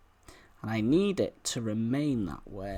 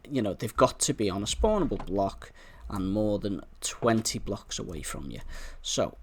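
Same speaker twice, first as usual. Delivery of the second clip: ordinary talk, nothing marked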